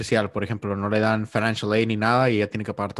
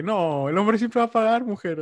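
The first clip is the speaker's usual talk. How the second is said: higher, slow put-on voice of a proud macho; mock macho man; 10 semitones higher than usual. Mocking a macho man telling his date he always pays. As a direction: ham up a man who insists on paying.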